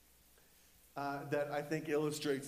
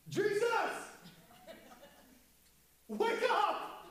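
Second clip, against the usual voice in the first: high, urgent yell; panicked disciples; 3 dB louder than usual. Acted. Disciples waking Jesus in a sinking boat, frantic high shout. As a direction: yell to shake a sleeper awake. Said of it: "I think they were like".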